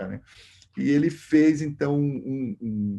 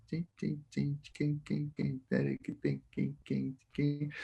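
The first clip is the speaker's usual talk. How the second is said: soft plucked imitation; 11 dB quieter, slower than usual. Imitating cavaquinho chords.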